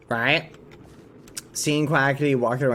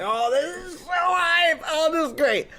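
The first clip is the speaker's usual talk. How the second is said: over-the-top hype; 13 semitones higher than usual. Retold or imitated. Streamer acts arriving at the lounge, over-the-top hype. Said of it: "I was so excited to see".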